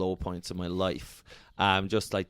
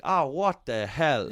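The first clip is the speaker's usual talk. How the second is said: slow shocked; 4 semitones higher, much slower than usual. Retold or imitated. Retold shock at the door.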